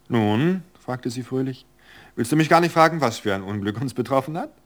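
a sprightly wife brushing off worry with a laugh; cheerful Virgilia. Acted. Virgilia teasing Albert.

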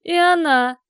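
put-on drunken yell; loud shout. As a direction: bark it out, loud and high. Drunk man shouting answer.

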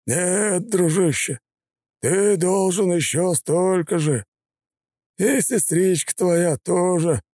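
high, slow, oily gangster; sneering thug voice. A narrator's reading. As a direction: taunt him with menace.

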